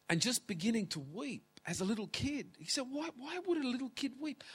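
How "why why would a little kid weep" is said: high, quick puzzled question; puzzled questioner. Retold or imitated. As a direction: imitate a baffled questioner, pitched well up. Voices a questioner, mock puzzlement.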